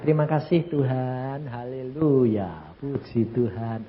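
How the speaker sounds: quiet, slow thankful praise; soft grateful prayer. Retold or imitated. Wife softly thanking God after receiving tongues, slow. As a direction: whisper thanks to God, overwhelmed. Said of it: "Dia bilang".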